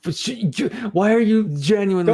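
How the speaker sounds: loud, high-pitched, stumbling complaint; worked-up protest. Real, not acted. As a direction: burst out in protest, tripping over words. Protesting against teasing about the accent.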